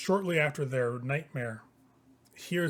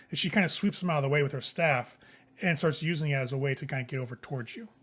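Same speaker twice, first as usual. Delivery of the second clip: ordinary delivery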